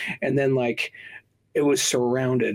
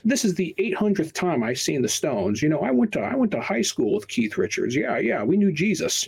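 mock boastful boomer; 7 semitones higher than usual.

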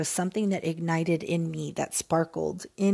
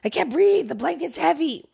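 high, loud mock panic, gasping complaint; hammed-up cry of suffocation; whining that the blanket is too heavy; 8 semitones higher, 4 dB louder than usual. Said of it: "You can't be laying on top of a blanket and like".

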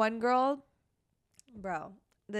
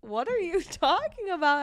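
mock shocked relative; 7 semitones higher, 5 dB louder than usual. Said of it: "everybody in his whole family's like".

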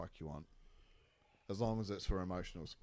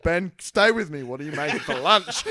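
urgent mock plea; 9 semitones higher, 14 dB louder than usual. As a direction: beg him to stay focused.

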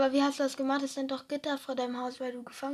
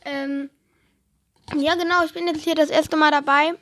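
much louder announcer push; loud announcement; 12 dB louder than usual. Pretend. Friend told to plug Instagram, loud announcement. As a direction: broadcast it loudly to the listeners. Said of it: "dass sie mir alle auf Insta folgen sollen".

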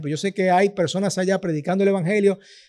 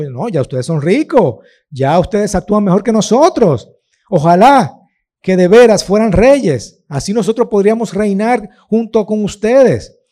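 louder, mock-admiring praise dripping with irony; ironic rebuke; 3 semitones higher, 6 dB louder than usual. Paul mocking the proud Corinthians, louder and sarcastic. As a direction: flatter them sarcastically to shame them. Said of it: "Pablo irónicamente le está diciendo".

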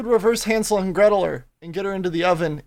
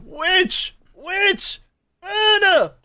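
high-pitched witch-hunt chant; acted shouting, pitched far above normal talk; mock crowd shouting; 12 semitones higher, much slower than usual. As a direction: call it out like a village mob. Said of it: "All of us shouting".

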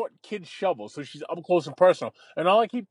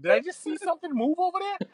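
startled; 10 semitones higher than usual. Retold or imitated. Spotting a rat, retold.

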